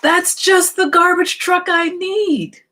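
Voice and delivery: a delighted buyer who has found exactly what he wanted; friendly man telling the little truck he has found what he needs; friendly man's voice. Acted. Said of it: "He said".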